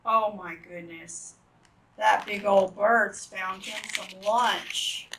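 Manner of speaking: usual speaking voice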